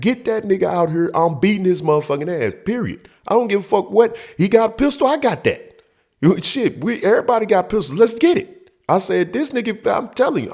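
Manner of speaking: high, pressing tirade; acting out a worked-up threat; angry threats. Acted escalating threats.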